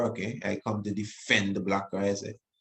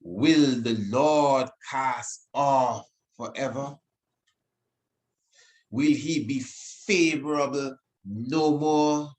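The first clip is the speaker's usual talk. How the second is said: solemn scripture reading; 4 semitones higher, slower than usual. Acted. Reading the psalm aloud.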